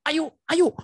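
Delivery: high, loud eager coaxing; urging. Retold or imitated. Girlfriend pressing him for the handkerchief, high eager urging. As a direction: come on, hurry up, give it here.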